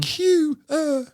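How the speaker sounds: put-on squeaky high child voice; high kid voice. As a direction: say it like a proud little kid. Same word again, high voice.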